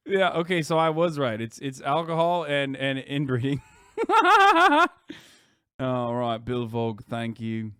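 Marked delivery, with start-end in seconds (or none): 4.0-5.2 loud, high burst of laughter. Real, not acted. Streamer cracking up at own inbreeding joke, high laughing.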